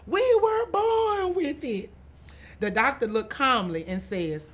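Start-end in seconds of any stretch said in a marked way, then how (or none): 0.1-1.9 much higher, slow, drawn-out scream of the words; a little girl standing on a chair screaming her announcement; screaming at top of lungs. A narrator's reading. Girl on waiting room chair announces to everyone, screaming. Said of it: "screaming to the top of my lungs".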